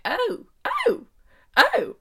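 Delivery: high, delighted squealing oh's; breathless teenage squeal; giddy squeals. Retold or imitated. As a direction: squeal like a smitten teen. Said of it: "'cause I was like".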